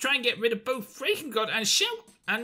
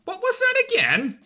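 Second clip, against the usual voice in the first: much higher squawking bird voice; Chatot's voice; 10 semitones higher, 5 dB louder than usual. Acted. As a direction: squawk it up high like an indignant parrot. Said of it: "I've lost my voice for Chatot".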